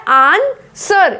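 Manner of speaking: आंसर split slowly, "aan... sar"; silent w. Shown as a demonstration. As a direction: pause inside आंसर, no w. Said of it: "डब्ल्यू की कहीं से आवाज़ नहीं". (आंसर को धीरे "आन-सर" तोड़कर)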